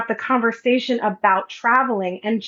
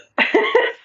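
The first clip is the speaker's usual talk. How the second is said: high airy laugh; 12 semitones higher than usual. Real, not acted. Laughing at the irony.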